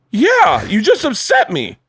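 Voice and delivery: high, heated mock protest; retold upset. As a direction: replay getting worked up, lightly hammed. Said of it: "And then I got upset".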